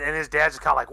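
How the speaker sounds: usual speaking voice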